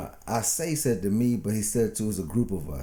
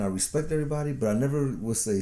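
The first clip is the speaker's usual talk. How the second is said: ordinary talk, nothing marked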